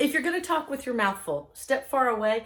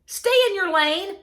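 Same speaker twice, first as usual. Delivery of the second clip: husband's retort; 9 semitones higher, 8 dB louder than usual. Retold or imitated. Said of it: "my husband's line is".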